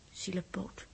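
fluisterend medelijden met de gewonde hand; zacht. Acted. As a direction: zacht en meelevend tegen Jaap. Els verzorgt Jaaps verbrande hand. (Els fluistert meelevend tegen Jaap)

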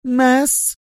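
very high, sharp woman's guess; sharp high-pitched demand. A narrator's reading. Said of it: "فعادت تقول بصوت أشد حدة".

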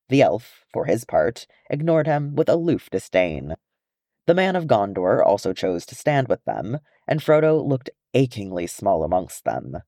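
ordinary delivery, nothing marked

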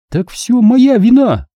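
slow, high-pitched outrage; offended, stretched-out protest; indignant. A narrator's reading. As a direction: throw the blame back, drawn out and high. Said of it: "негодовала Сири".

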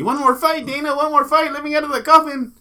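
high-pitched, loud pleading caricature; hammed-up high scream impression; mock screaming. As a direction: yell it like you're trapped and begging. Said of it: "B J was down there screaming".

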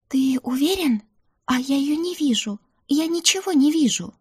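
high-pitched, voiced stage whisper; thin high voice of anxious disbelief. A narrator's reading. Mother searching for her unseen daughter, whispering. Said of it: "прошептала мама".

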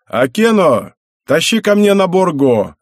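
put-on old man calls a servant from the next room, loud and high; calling out. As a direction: shout it into the hallway. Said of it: "крикнул".

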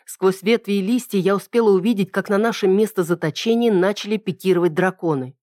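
ordinary delivery

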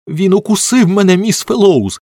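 high, loud, outraged wail; angry high-pitched yell; furious child's cry. A narrator's reading. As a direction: cry out in fury to the grown-up. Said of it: "розлючено кричав Джері".

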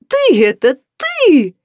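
high, loud joyful hollering; insistent yell. A narrator's reading. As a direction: bellow it in triumph. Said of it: "ещё громче завопила оглобля".

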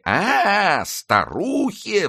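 high, drawn-out outraged cry on старухе; offended shriek. A narrator's reading. Nanette insulted at being called old, slow high outraged cry. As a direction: stretch старухе in shocked offence. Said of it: "вскричала Нанетта".

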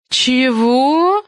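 long rising question; drawn-out amazed query, ending high; astonished. A narrator's reading. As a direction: stretch it upward in disbelief. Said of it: "удивлённо спросила Галя".

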